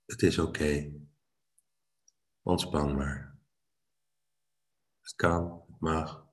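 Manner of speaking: hushed, close and reassuring; soft intimate murmur to the listener; right in your ear. Acted. Voicing soothing inner message.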